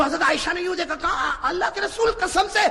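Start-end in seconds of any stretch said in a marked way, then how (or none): 1.4-2.6 high, loud laughing mock alarm; a young wife teasing, let's run too; Aisha playfully alarmed. Aisha laughing to the Prophet, mock panic, high and loud.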